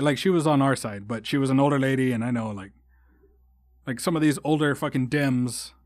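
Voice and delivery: ordinary talk, nothing marked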